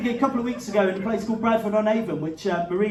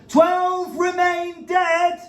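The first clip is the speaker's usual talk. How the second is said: high, loud, very slow proclamation; dramatic headline read; 9 semitones higher, 4 dB louder, much slower than usual. Pretend. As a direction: boom it out like an announcer.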